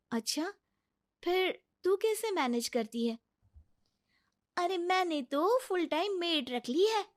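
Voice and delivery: high, lively gossiping voice; chatty office friends. Acted. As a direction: swap childcare news like close colleagues. Office friends chat about maids.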